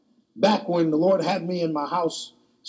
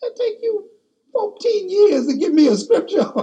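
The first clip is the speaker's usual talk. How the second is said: much higher, laughing teasing complaint; laughing at God; 4 dB louder than usual. Retold or imitated. Preacher teasing God about the fourteen-year wait, laughing. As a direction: tease God through a laugh. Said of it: "I said".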